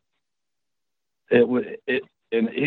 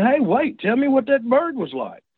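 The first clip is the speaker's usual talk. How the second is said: eager call; 9 semitones higher than usual. Retold or imitated. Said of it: "'Cause I'm thinking".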